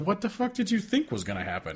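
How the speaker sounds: high-pitched, disbelieving question; incredulous. Acted. Reacting incredulously to singer's complaint.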